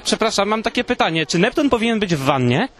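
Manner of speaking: usual speaking voice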